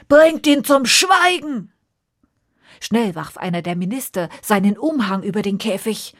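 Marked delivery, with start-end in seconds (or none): 0.1-1.7 loud, high, slow furious order; angry command. Acted. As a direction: roar it like an enraged king. King jumps up enraged.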